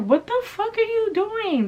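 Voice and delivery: very high-pitched indignant cry; reenacted outraged yell. Date stomps on her AirPod, reenacted outraged yell. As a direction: shriek it in retold disbelief.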